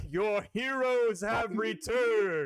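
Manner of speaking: loud, slow booming proclamation; booming thunder and wind. Acted. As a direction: boom it across the crowd. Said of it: "a booming voice echoes through the crowd".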